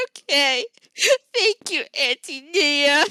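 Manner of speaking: high, loud, slow teary thanks; sniffling child. Acted. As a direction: sniffle out the thanks through tears. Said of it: "he's crying".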